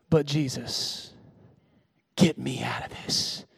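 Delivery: quiet weary prayer. Retold or imitated.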